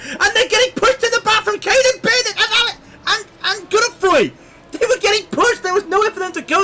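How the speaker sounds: high, fast excited commentary. Real, not acted. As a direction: keep the crash call urgent. Live crash commentary continues.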